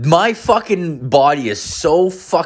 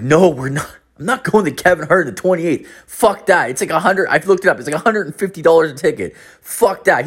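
retold protest, pitched up; mock-outraged, refusing point-blank in a higher voice; indignant refusal; 6 semitones higher than usual. Caller tells buddy he won't pay for tickets, mildly indignant.

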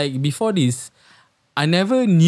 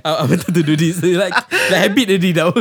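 laughing it off, a bit higher; 3 semitones higher, 4 dB louder than usual. Real, not acted. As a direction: giggle through the excuse. Flustered after pressing button.

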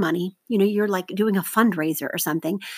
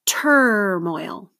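"Turmoil" with TUR drawn out; stress on first syllable. Shown as a demonstration. Teacher models for seventh graders to mark, stretched stressed TUR in "Turmoil". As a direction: say "Turmoil" as TUR-moil, falling away after TUR. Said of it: "circle the stress syllable".

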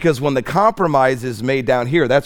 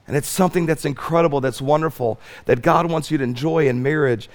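ordinary talk, nothing marked

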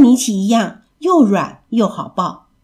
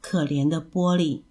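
soft, lowered sigh of sympathy; sighing pity; 4 semitones lower, 8 dB quieter than usual. A narrator's reading. Mother pitying her child to herself, soft sighing tone. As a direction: say it low and quiet, with an inward sigh. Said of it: "妈妈心里叹了口气说".

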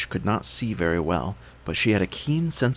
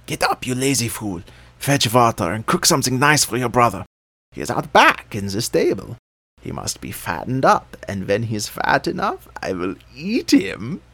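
harsh witch voice; 3 semitones higher, 4 dB louder than usual. A narrator's reading. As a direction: bark orders like a cruel witch. Witch voice in story.

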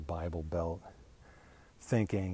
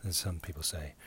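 normal talking voice

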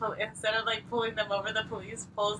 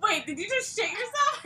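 mock shocked guy; 8 semitones higher than usual. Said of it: "And then he's like".

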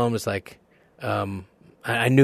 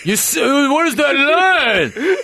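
slow, much higher furious yell; Stallone shout; 7 dB louder, much slower than usual. Retold or imitated. Stallone yelling at the actor about the line, slow furious shout. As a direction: explode like Stallone.

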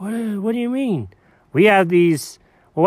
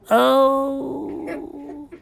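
lost voice; 7 semitones higher than usual. Acted. Cat waking after a wild night, lost voice. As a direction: groan with the voice nearly gone. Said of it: "I lost my voice".

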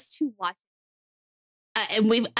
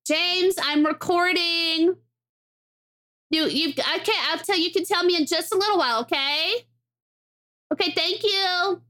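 calling out; 7 semitones higher than usual. Real, not acted. Calling to someone at door.

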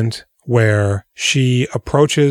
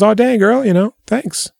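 high, hammed-up delighted thanks; a lady thrilled by her drink, mock gushing; mock surprised thanks; 11 semitones higher than usual. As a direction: gush over the hot chocolate, much higher. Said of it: "She goes".

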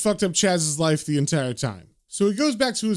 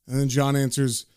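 usual speaking voice

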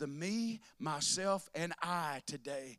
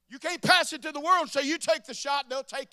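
building preaching; 7 semitones higher, 8 dB louder than usual. Real, not acted. Rising preaching.

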